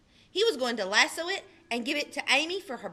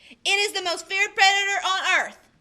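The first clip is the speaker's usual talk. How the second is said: put-on boastful boy; 8 semitones higher, 9 dB louder than usual. Austin trying to scare Amy.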